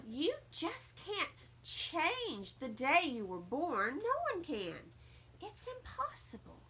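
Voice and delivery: put-on calm a child down; soft, patient mother; gentle mother.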